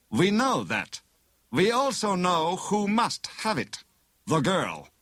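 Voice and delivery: high, deliberate villain voice; bogus inspector. A narrator's reading. Bogus inspector reveals who has the letter, knowingly menacing.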